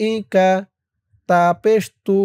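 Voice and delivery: usual speaking voice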